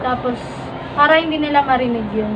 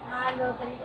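whispering; 13 dB quieter than usual. Real, not acted. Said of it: "whisper lang talaga".

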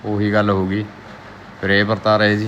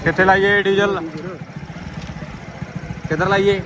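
calling out; 10 semitones higher than usual. Real, not acted. Calling out at pump.

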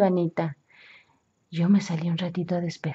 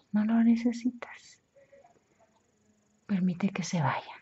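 hypnosis guidance. Acted. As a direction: plant the suggestion gently. Hypnotic suggestion, partly whispered. (sugestión hipnótica suave)

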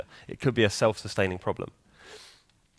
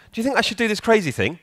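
mock question; 10 semitones higher, faster than usual. Acted question to bath duck.

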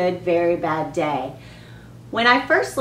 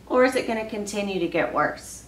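ordinary talk

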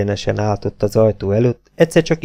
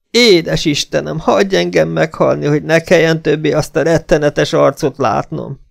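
high-pitched despairing prayer; wretched wailing; 6 semitones higher than usual. A narrator's reading. Esmeralda praying never to see that face, wailing. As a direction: beg desperately in a raised voice. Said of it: "kiáltotta a szerencsétlen asszony".

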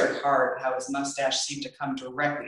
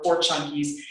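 ordinary delivery

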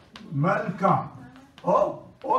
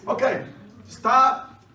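loud call to order; sharp stop; 5 semitones higher, 6 dB louder, slower than usual. Real, not acted. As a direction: snap the class to attention. Teacher calling class to order.

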